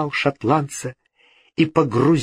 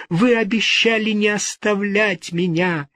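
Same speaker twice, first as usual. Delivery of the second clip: desperate cry; 9 semitones higher than usual. A narrator's reading. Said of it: "вскричала в отчаянии".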